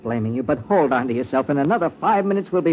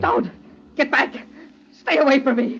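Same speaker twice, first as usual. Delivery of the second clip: high, panicked warding off; terrified; 8 semitones higher than usual. Acted. Man recoiling from his dead victim, terrified. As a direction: push the ghost back in panic.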